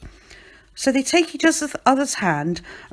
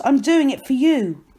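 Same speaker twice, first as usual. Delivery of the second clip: raised, louder reassurance; calling out; 6 semitones higher, 5 dB louder than usual. A narrator's reading. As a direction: call it out to loved ones. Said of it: "he calls".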